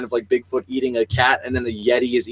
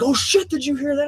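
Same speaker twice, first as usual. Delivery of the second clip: mock alarmed shouting; 11 semitones higher than usual. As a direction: mimic a startled ghost hunter, pitched up. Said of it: "everybody's shouting".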